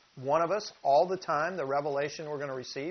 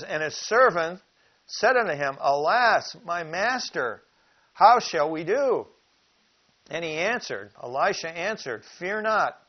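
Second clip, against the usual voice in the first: scripture reading; 3 semitones higher, 6 dB louder than usual. Acted. Preacher reading the servant's cry and Elisha's answer to the flock, loud and dramatic. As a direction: voice the servant's alarm and Elisha's calm.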